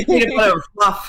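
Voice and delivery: broad Boston accent, playing it up. Shown as a demonstration. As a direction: lean into the Boston vowels. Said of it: "My Boston accent".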